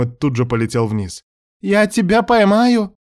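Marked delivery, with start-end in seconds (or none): 1.6-2.9 high, loud, drawn-out shout; yelling. A narrator's reading. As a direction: yell it high to someone falling. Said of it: "проорал Мелайдес".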